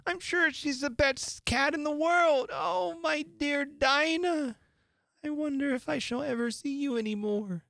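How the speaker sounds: miss someone dearly, close to tears; plaintive lament. A narrator's reading. Lonely Alice laments her absent cat, high and plaintive.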